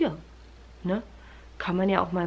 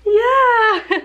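much higher, sing-song cooing with giggles; 10 semitones higher, 6 dB louder than usual. Real, not acted. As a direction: coo at a little one who just woke up happy. Greeting her just-woken toddler.

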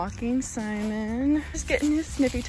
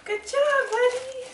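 high, louder excited praise; 3 dB louder than usual. Real, not acted. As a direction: cheer the dog on. Praising dog in booties.

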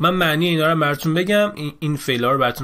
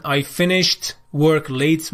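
slow dictation read; much slower than usual. Shown as a demonstration. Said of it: "reading answers slowly".

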